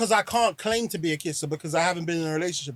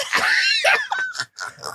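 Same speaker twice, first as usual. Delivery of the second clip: very high shrieking laughter. Real, not acted. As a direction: burst into a squealing laugh.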